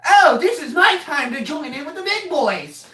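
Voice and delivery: high-pitched mock-eager youngster; imitating eager kid. Cousin joining the big boys, high eager imitation. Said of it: "Then Clay was like".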